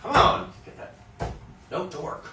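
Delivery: mock yell at yourself in the practice room; hammed-up impatient shout at a stubborn note; frustrated self-push. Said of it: "I was like".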